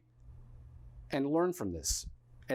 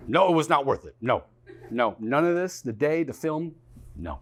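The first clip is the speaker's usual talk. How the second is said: louder, insistent repeated refusal; emphatic loud no; 6 dB louder than usual. Retold or imitated. Reg answering the cop, loud and emphatic. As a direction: slam each no down hard. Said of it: "And Reg goes".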